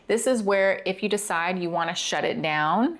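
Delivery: usual speaking voice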